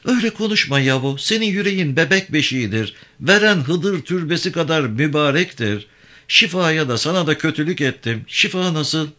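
high-pitched woman's character voice; distressed woman. Acted. Avke protesting Yavan's forgiveness, distressed woman. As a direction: voice her high, pleading and troubled.

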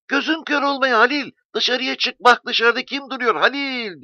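high, loud call through the door; shouting. A narrator's reading. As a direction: shout it out to him, voice way up. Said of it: "diye bağırdı".

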